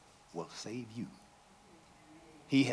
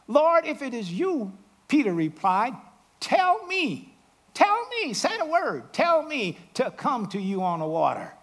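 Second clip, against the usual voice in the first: eager pleading; 7 semitones higher, 4 dB louder than usual. Acted. Peter pleading with Jesus.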